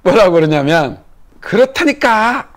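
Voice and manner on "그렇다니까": higher, slow mock irritation; irritated insistence. Girl snapping at the boy, slow exasperated mock irritation. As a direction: drag it out like a fed-up little girl. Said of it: "이제 짜증을 확 내는 거예요".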